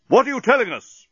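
high, urgent captain's shout; yelling. A narrator's reading. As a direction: demand an answer at a yell. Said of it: "yelled Captain Bolfas".

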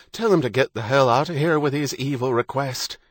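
high-pitched, vehement outburst; angry inner urging. A narrator's reading. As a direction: let the inner protest burst out. Husband's angry thought builds.

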